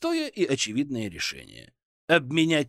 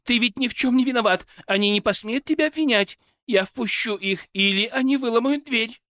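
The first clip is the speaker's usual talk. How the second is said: high, loud pleading cries; urgent shouting; 9 semitones higher, 4 dB louder than usual. A narrator's reading. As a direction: shout urgently across the room. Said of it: "закричала женщина".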